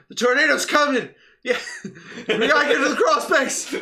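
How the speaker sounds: high, loud alarmed warning, laughing through it; wife warning husband of the tornado, panicked. Retold or imitated. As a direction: sound the alarm in a frenzy.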